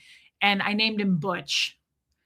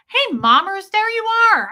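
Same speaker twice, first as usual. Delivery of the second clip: loud, much higher, delighted hello; real excited greeting; 13 semitones higher, 8 dB louder than usual. Real, not acted. Streamer greeting a missed regular in chat, excited. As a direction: call out to a friend who has just turned up.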